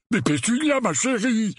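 high, plaintive protest; deflated pleading. A narrator's reading. Uncle Vernon protesting to his wife, deflated pleading.

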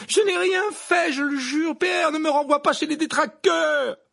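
very high, loud frantic pleading; terrified boy's high wail; piercing cry. Acted. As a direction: shriek your innocence, begging. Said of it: "d'une voix perçante".

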